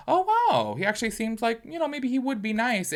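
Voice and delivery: high-pitched, wondering retold reaction; pleasantly surprised. Vlogger recalling seeing someone's kinder side, pleasantly surprised. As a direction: start on a high "wow", then stay lifted.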